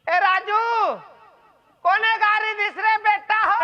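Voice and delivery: calling out. Acted. Summoning Raju and the others, calling out. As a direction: call across a distance, pushing the pitch up. Calling out names of absent people.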